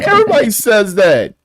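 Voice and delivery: high, loud playful outburst; mock-emphatic yell; hammed-up shout. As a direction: belt it out high for laughs. Hamming up a phrase from the list.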